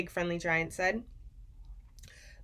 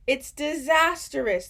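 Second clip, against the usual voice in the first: gurgling; 6 semitones higher, 6 dB louder, slower than usual. A narrator's reading. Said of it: "the B F G gurgled".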